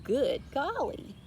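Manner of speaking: slow, lightly voiced cow; an awed cow drawling; Mabel marvelling. A narrator's reading. Mabel the cow awed by the flashing lights. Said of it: "And Mabel said".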